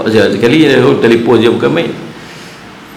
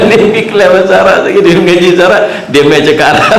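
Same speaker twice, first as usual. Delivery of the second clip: laughing; 8 semitones higher, 3 dB louder, faster than usual. Real, not acted. Laughing while retelling.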